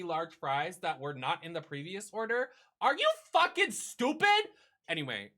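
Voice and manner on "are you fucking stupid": indignant outburst. Real, not acted. Ranting about a missed refund.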